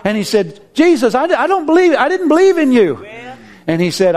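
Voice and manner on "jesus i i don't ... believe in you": high, strained plea to Jesus; pleading confession. Retold or imitated. Man before Jesus, confessing in high earnest. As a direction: confess it with a catch of awe.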